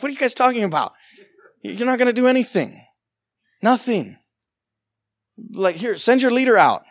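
dismissive amusement. Acted. Person talking down to ants at an anthill, softly dismissive. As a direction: brush off a puny threat, half amused.

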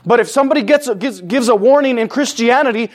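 ordinary delivery

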